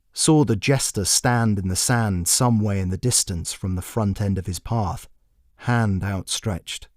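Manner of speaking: usual speaking voice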